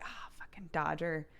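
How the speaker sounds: half-whispered mock complaint; mock grumbling. Friends making fun of her vlogging, grumbled. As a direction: grumble it under your breath.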